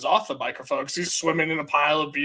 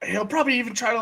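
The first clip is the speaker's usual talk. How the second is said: normal talking voice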